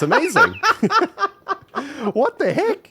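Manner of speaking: high squealing laughter through the words; laughing hard. Real, not acted. Laughing at his friend's clever building trick, high and giggly. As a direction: crack up at the trick mid-sentence.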